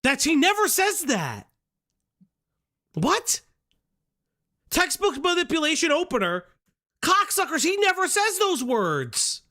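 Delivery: high-pitched, indignant protest; outraged disbelief. Real, not acted. Streamer disputing a critic's claims, outraged. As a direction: cry out incredulously at a false claim.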